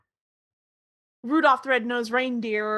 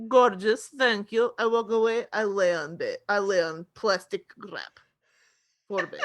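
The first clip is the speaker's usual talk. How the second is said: mock-Russian cat-man voice; Russian accent. Actor as cat admiring his bed, Russian accent. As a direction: put on a broad Russian accent, dropping small words. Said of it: "so now he's just Russian".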